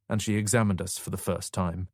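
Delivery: usual speaking voice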